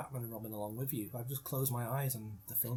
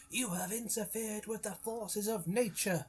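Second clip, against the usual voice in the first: big theatrical speech; 8 semitones higher than usual. Retold or imitated. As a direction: deliver it like a ranting film executive. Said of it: "he does this really big speech".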